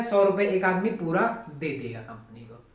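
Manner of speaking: normal talking voice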